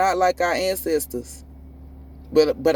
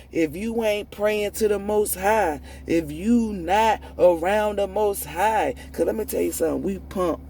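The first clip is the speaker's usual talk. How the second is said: ordinary talk, nothing marked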